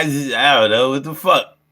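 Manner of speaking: ordinary delivery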